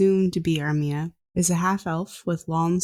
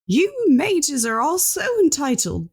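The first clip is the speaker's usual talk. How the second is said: put-on indignant old scientist; 9 semitones higher, 4 dB louder than usual. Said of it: "And he points at her".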